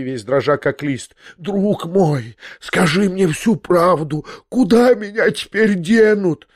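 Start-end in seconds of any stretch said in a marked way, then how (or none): 1.4-6.5 high, slow, trembling plea; terrified. Acted. Kneeling old prince begs narrator for truth, terrified and high. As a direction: beg in rising panic.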